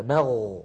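low "مغ" with the ghayn held; flowing ghayn. Shown as a demonstration. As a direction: sustain the ghayn at the end of "مغ". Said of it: "لاحظوا هكذا". («مغ» بغين ساكنة جارية)

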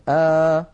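open, medium alef aa held loud; middle open alef. Shown as a demonstration. Teacher to students, showing the middle alef sound. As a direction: say آ with the mouth half open. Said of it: "فتحا وسطا".

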